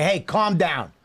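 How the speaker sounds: brisk, full-voiced "calm down"; snapping out of it. Shown as a demonstration. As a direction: say it firmly, to jolt a friend out of it. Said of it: "I'm snapping you out of it".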